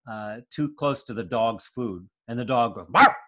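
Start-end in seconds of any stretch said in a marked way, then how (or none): 2.9-3.1 a single sharp imitated bark; dog bark. The dog warning the baby off its food, a sharp bark.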